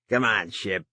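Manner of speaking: a touch of anger, sharp. A narrator's reading. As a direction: snap at him impatiently. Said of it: "Tito Apostol said with anger".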